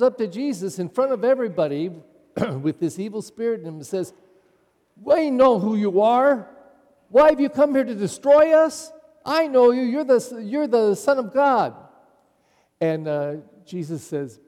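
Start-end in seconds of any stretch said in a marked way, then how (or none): 5.0-11.8 loud, high shout of a possessed man. Acted. Possessed man confronting Jesus in the synagogue, loud and high. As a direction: hurl it at Jesus, higher and louder. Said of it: "he was shrieking and saying awful things".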